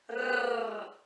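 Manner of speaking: a long trilled rrr; rolled R. Shown as a demonstration. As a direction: roll the tongue, rrr. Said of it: "vibra a língua no céu da boca".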